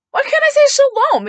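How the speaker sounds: very high, loud mock outrage; squawked-up, affronted comeback; indignant protest. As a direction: imitate an offended mother protesting. Mother's indignant comeback, acted.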